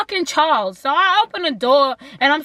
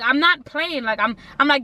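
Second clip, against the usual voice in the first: ordinary talk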